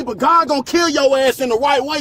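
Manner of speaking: ordinary talk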